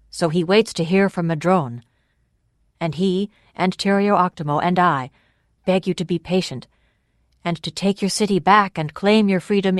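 loud, measured public address; ringing, formal appeal; orator addressing a crowd. Acted. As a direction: project to a packed square, asking for a hearing.

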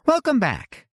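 high, bright-pitched woman's voice; Winnie's voice. A narrator's reading. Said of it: "said Winnie".

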